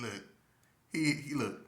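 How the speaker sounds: ordinary talk, nothing marked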